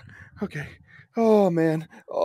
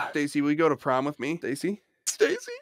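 asking someone out; 10 dB quieter than usual. Pretend. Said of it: "that was my friend's mom".

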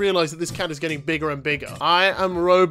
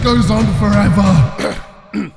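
slow, dramatic movie-trailer announcer, higher and louder; mock trailer voice; 4 semitones higher, 5 dB louder, slower than usual. Gamer hyping the game to his viewers like a trailer announcer. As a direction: sell the game like an epic trailer.

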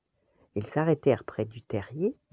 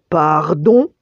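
loud, indignant mother bear; grondement indigné; 10 dB louder than usual. A narrator's reading. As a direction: growl it loudly, rising in offence. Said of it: "gronda la mère ours". (maman ourse qui gronde)

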